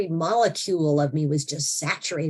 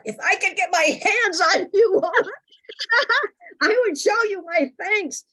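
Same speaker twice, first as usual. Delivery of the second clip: mock threat. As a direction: threaten them half-joking. Comic threat to her family.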